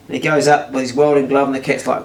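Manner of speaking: plain reading voice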